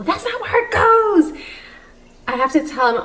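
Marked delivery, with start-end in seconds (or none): 0.0-1.4 mock outburst, pitched way up; flying off the handle. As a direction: act out an exaggerated high-pitched snap. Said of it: "just fly off the handle and go".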